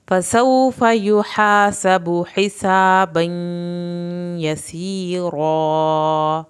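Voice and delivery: slow chanted recitation; Quranic recitation. Shown as a demonstration. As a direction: recite melodically with tajweed. Verse recited as model.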